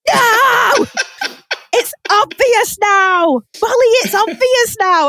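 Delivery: high, loud, drawn-out mock shout; exasperated yelling. Viewer yelling at Molly on screen, exasperated shout. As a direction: holler it like a viewer at the telly.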